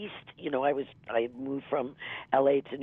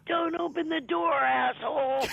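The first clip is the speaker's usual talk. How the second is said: put-on New York accent; 13 semitones higher than usual. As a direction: shout it like a rowdy New York moviegoer. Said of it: "a guy with a New York accent".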